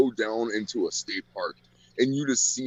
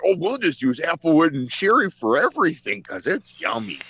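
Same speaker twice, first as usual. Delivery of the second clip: higher, airy mock of a naive cook; sing-song mock of a northern griller; mocking clueless cook; 7 semitones higher, 3 dB louder than usual. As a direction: parody them as clueless and pleased with themselves. Mocking northern barbecue cooks.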